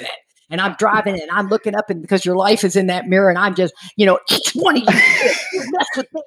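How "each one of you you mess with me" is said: mock menace, eyes wild; crazy eyes threat. Bus driver warning the rowdy kids, mock crazy threat. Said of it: "I'll show you crazy eyes".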